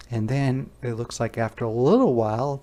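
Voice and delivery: ordinary delivery, nothing marked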